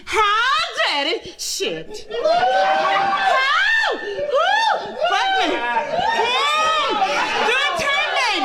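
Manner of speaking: very high, loud mock screaming; landlady screaming in bed, hammed-up high shrieks; hammed-up sex screams. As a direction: shriek it like an over-the-top bedroom scene. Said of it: "They started screaming".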